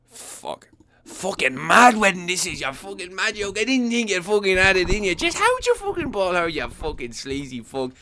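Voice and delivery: mock-hype a rowdy Conor at the mic; McGregor impression. Groom imagining Conor yelling at the wedding, put-on brash voice. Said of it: "what McGregor would say on that mic".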